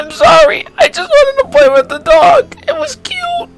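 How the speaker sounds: put-on very high-pitched wailing little kid voice; hammed-up sobbing kid, squeaky high; crying child. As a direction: play a tearful kid blurting out an apology. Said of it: "like, starting to cry".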